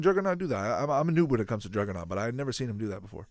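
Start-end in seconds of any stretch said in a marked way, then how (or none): none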